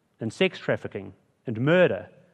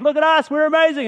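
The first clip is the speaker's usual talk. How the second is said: very high, loud, silly self-praise; preacher mocking proud people boasting; 8 dB louder than usual. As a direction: puff yourself up like a braggart. Builders boasting, mocked by preacher.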